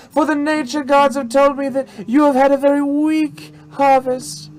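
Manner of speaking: high-pitched madam proclaiming a blessing; mystic show madam. Acted. Madam grants a farmer a blessing, proclaiming in high voice. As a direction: deliver the blessing in a high stage voice. Plays the madam fortune teller.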